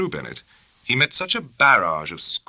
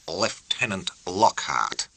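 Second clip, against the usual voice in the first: plain reading voice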